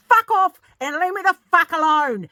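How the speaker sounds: high, very loud enraged yell; screaming. Retold or imitated. Mum throwing egg cartons onto the driveway, furious scream. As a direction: yell it with all your fury. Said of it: "I just started screaming at him".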